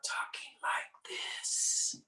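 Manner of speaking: whispered through a narrowed throat; throaty whisper. Shown as a demonstration. Yoga teacher showing the class the whispered ocean breath. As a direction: breathe the words out with no voice. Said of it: "So as opposed to talking like this".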